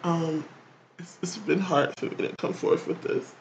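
high, soft fake sobbing voice; mock crying. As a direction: pretend to cry through the words. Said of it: "clearly he's crying".

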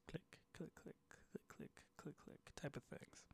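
soft whispered click sounds. Acted. Imitates the clacking balls.

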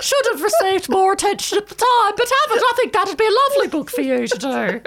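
put-on high, genteel old lady voice. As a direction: play a prim, interfering old lady. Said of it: "I might speak with this voice".

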